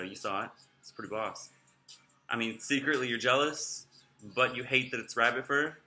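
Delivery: ordinary delivery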